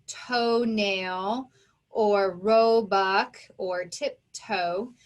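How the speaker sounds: example words said slowly and deliberately; slow down on each example word. Shown as a demonstration. Teacher slowing down to list example words for the class. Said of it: "compound words like".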